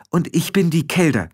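high-voiced firm interruption; sharp matriarch; commanding. A narrator's reading. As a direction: cut him off with authority, pitched high. Matriarch cuts Rob off.

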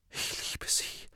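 breathless whisper. Acted. Ron repeating his love to Harry, breathless whisper. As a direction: barely breathe the words out. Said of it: "wiederholte Ron atemlos".

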